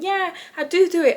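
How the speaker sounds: high, bright mock reply; a hint of a dismissive roommate. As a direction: parrot her breezy denial. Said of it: "She's saying".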